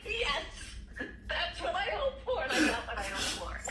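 high and loud, laughing through the words; delighted, can't stop laughing; real joyful laughter. Real, not acted. [name] laughing with joy at Yusuf's news about his dad.

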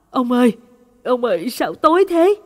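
frightened calling out. A narrator's reading. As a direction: call for a husband in fear of the dark. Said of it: "Bà Hà sợ hãi gọi". (tiếng gọi sợ hãi, cao giọng)

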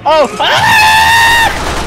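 sudden high shriek; panicked scream. Real, not acted. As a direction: scream as you get grabbed. Dying in game.

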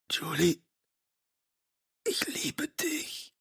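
dying breath of love, slowly; father's last faint words; barely audible. A narrator's reading. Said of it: "Seine Stimme war kaum noch zu hören".

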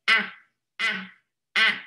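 short a, ah, ah, ah; short a sound. Shown as a demonstration. Teacher modelling the short a sound for children. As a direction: say "ah" with the mouth open wide. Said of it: "A says".

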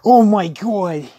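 loud, high, drawn-out cry of exasperation; exasperated outburst. Real, not acted. Singer fed up with their own botched song, exasperated wail. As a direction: wail it out in frustration.

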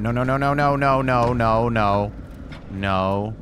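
loud rising string of no; begging it to stop; panicked no. Real, not acted. Gamer dragged off by a creature, panicking.

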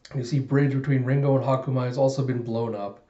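ordinary delivery, nothing marked

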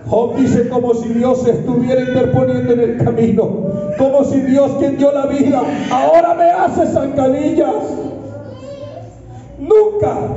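rally the crowd, drive every word; full-force preaching. Acted. Pastor urging the congregation to march, shouting high.

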